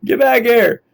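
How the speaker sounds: yelling. Real, not acted. Kiteboarding coach to student drifting further away, yelling. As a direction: call out loudly to someone getting away. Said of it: "I'm yelling at you".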